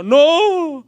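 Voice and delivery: sudden high, loud shout of no. Retold or imitated. A churchgoer shouting no at the preacher's question. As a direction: blurt a loud high refusal. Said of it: "Y alguien gritó".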